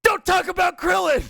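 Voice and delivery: high, mock-outraged yell; high-pitched overblown warning; imitated outburst. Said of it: "It's got the same energy".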